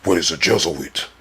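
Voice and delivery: lightly voiced devil, effects not working; deep devil voice. Mock devil reading to the stream, lightly done. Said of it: "I was gonna get in the deep devil voice".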